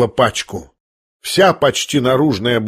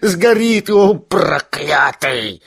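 high, slow, frantic exclamation; acted roar; 6 semitones higher, slower than usual. A narrator's reading. Ferdyshchenko tugging Ganya toward the fire, roaring. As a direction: yell high, then grind out the curse slowly. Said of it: "заревел Фердыщенко".

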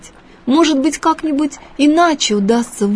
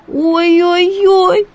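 slow drawn-out higher ouch; exclaiming; 9 semitones higher, 4 dB louder, much slower than usual. A narrator's reading. Shrimps tug the boy's hair, drawn-out higher ouch. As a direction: cry out at the tugging. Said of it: "воскликнул про себя Евсейка".

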